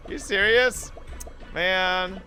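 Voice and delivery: normal talking voice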